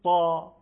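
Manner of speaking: تا loud and thick, set against a light ت; loud emphatic ta demo; heavy emphatic ta. Shown as a demonstration. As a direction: hit تا hard as an emphatic ط.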